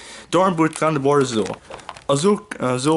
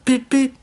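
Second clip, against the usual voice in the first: high piped beep; imitated whistle; 12 semitones higher, 3 dB louder than usual. A narrator's reading. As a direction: whistle back like an engine. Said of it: "Thomas whistled back to them".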